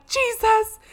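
high, loud wailed plea; mock sobbing. Sobbing aunt calls out to Jesus, wailing. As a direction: cry out as if sobbing. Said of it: "she's, like, sobbing".

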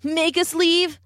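high, mocking laugh through the words; laughing scoff. A narrator's reading. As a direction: laugh it off with scorn. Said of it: "laughed a cheetah".

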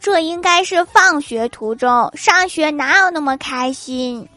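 put-on high, bright-sounding girlish voice; girlish wife. Wife tells husband the photo title is wrong, in a high girlish voice. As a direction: play the sassy young wife.

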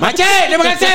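high-pitched imitation of an auntie's thanks; mimicking the auntie. Friend mimics the auntie thanking them, high-pitched imitation. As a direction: squeak it up like the auntie said it. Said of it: "Dia cakap".

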